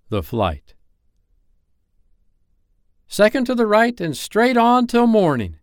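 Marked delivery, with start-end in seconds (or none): 3.1-5.6 put-on very high, loud, ringing boy voice; high-flown childlike announcement; boy giving directions. A narrator's reading. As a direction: proclaim the way like a boastful child. Peter's directions to Wendy, quoted.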